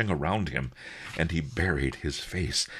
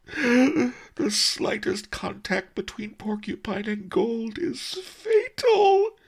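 much higher and louder, slow tearful wail; weeping; 5 dB louder, slower than usual. Acted. Merchant laments the fatal gold, slow loud weeping. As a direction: let the sobs stretch the words. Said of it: "he wept".

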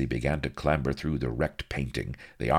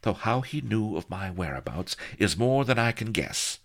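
higher, haughty heroine voice; puzzled lady; 7 semitones higher than usual. A narrator's reading. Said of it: "said she, frowning".

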